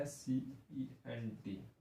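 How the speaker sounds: spelled out slowly; slow, separated letters for learners. Shown as a demonstration. Teacher spells out the word. (एक-एक अक्षर धीरे-धीरे)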